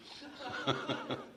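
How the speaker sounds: short breathy laugh; soft chuckle. Real, not acted. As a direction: trail off into quiet chuckling. Laughs after his question.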